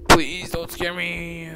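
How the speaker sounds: slow, loud, drawn-out begging; fearful plea. Real, not acted. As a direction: beg it slowly, dreading a scare. Pleading with the horror game.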